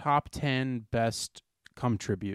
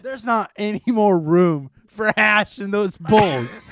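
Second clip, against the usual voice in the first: slow loud exaggeration; 7 semitones higher, 9 dB louder, much slower than usual. Exaggerated bit about packed bowls.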